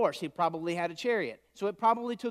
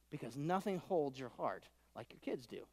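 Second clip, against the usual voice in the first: softer, heartfelt line; soft, tender; 5 semitones lower, 11 dB quieter than usual. Shown as a demonstration. Preacher to congregation, soft tender line before they repeat it. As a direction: say it gently as a confession. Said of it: "All the parents in the room say".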